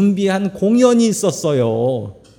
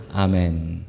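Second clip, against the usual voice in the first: ordinary talk, nothing marked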